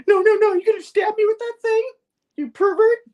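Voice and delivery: very high, loud hammed-up shriek; mock panicked refusal. Anna refusing Spidey's needle, mock panicked shriek. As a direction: play a frantic, accusing refusal.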